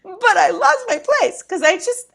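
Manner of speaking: high, loud comic wail; hammed-up tearful lament; mock wail. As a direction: protest as if about to bawl. Repeats her tearful inner complaint.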